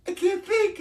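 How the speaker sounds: mock giddy bliss. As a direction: squeal it like you can't stop laughing. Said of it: "laughing hysterically".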